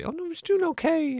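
squeaky caricature of a flustered teacher. Retold or imitated. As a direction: mimic the brother answering mother, way up high. Mother quizzing the brother, retold.

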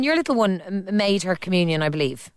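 normal talking voice

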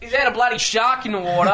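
high-pitched alarmed Aussie; role-play a shocked Australian; hammed-up high Australian accent; put-on Australian accent. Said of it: "the Australian with the Irish one".

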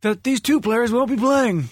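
put-on squeaky kids' TV reporter; Sesame Street reporter voice. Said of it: "reporter voice from Sesame Street".

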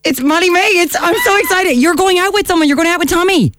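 high, gushing excited greeting. Real, not acted. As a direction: squeal it out with delight. Host greets Molly-Mae.

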